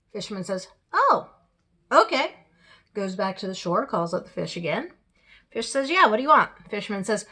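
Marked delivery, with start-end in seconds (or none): 0.9-2.4 high, loud, simple-minded "oh, okay"; a hapless husband giving in, pitched way up; compliant fisherman. Acted. Fisherman gives in to wife. 5.9-6.5 impatient fish. Acted. Fish answers fisherman's second call.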